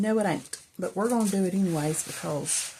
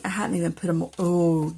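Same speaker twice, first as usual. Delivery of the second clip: ordinary delivery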